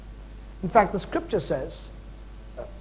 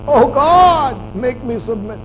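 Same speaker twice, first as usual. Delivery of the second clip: mock prayer; 4 semitones higher, 8 dB louder, slower than usual. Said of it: "this particular prayer".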